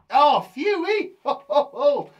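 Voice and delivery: big, loud, high whoop of relief; play-acted relief, loud and laughing. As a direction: let out a drawn-out phew and laugh it off. Relief that the puppet is okay.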